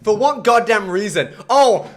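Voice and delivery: loud, high exasperated shout; furious at wasted effort; genuinely mad. Real, not acted. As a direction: rant and build to a yell. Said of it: "I'm actually genuinely mad".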